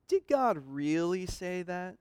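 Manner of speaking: sly serpent. Acted. Satan's leading question voiced.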